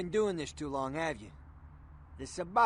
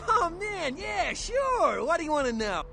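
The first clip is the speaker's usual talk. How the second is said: much higher and louder, bubbling over; eager greeting; 7 semitones higher, 8 dB louder than usual. Acted. Informant Knox gushing to the vampire, loud and excited. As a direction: gush like an overeager informant.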